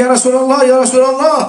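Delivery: high, loud frantic cries of alarm; desperate shouting. Retold or imitated. Zaid crying out to the wounded Prophet, high and loud. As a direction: call out in panic to wake him. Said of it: "beliau berteriak-teriak".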